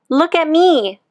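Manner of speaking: put-on a young beetle bragging to the world; proud little beetle. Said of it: "said the young click beetle".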